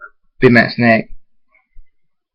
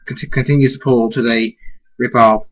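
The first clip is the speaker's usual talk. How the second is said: ordinary delivery, nothing marked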